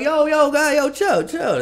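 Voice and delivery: very high-pitched hushing of a protest; calling for calm. Retold or imitated. Dad stopping his protesting son, high-pitched calming. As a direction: squeak out a plea to settle down.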